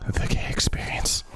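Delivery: hushed, mock-solemn whisper; voiceless deadpan reply; whispered stock answer. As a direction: whisper it like a secret punchline. Joins in with Roy's line.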